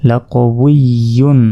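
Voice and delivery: ordinary talk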